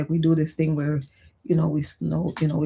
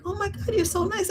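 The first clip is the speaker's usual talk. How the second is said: surprised strangers; 14 semitones higher, 5 dB quieter than usual. Retold or imitated.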